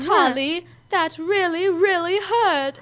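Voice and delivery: high, slow whine close to tears; imitating a British boy about to cry; near-tears kid. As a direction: drag out really hurt like a hurt little boy. Quoting the bitten boy.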